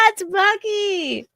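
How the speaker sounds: high delighted squeal, slow on the name; high, slow gushing squeal. Real, not acted. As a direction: squeal it like you just unwrapped something adorable. Squealing at baby romper.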